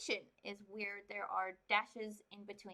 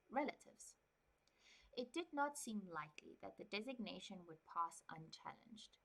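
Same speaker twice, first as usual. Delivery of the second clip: calm, even storybook reading; story narration; 2 semitones lower, 5 dB quieter than usual. Acted. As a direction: read it like a quiet novel. Reads novel aloud.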